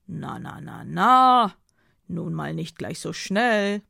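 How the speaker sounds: loud rising "hold on" protest, then slowing; admonishing. Acted. Dido holding off Philomena's demand for the camera, loudly admonishing. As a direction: check someone who is rushing you, swelling loud. Heated objection to her demand.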